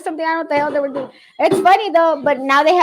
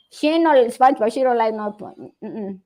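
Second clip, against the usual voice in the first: ordinary delivery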